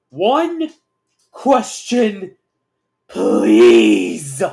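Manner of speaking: loud, pleading, word by word; shout. A narrator's reading. Aaron begs Pinky for one question, shouting. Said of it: "Aaron shouts".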